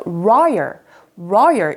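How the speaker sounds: royal started from a rounded 우 then pulled open; royal with curled R. Shown as a demonstration. Teacher showing students the 우-start royal. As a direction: round the lips on 우 and draw out royal. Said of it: "우처럼 발음했다가 이렇게 당기면서".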